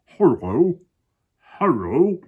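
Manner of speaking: high, loud, swooping hippo greeting; Humpy Rumpy. Acted. Hippo greeting the crocodile, high and swooping. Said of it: "said Humpy Rumpy".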